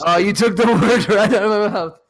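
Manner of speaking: real amused laughter breaking into the line; laughing reply. Real, not acted. Co-host joking back to a friend, laughing.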